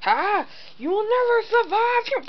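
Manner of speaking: high, slow villain boast. Acted. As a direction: gloat like a cartoon villain. Villain's dark attack speech.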